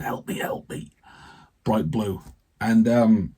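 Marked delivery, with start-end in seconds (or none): 0.0-0.9 breathless whispered cry for help; desperate plea. Retold or imitated. Patient waking his wife, whispering for help. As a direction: gasp it out, no air left.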